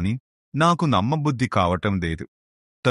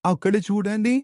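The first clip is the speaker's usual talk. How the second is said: much higher, urgent calls to the master; urgent calling out; 11 semitones higher than usual. Acted. Disciple calling his guru to the drowning woman, high and urgent. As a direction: shout excitedly pointing at the river.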